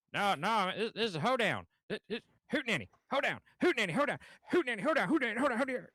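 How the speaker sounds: high, loud, rapid back-and-forth insistence; heated southern argument. Retold or imitated. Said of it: "two guys were having an argument".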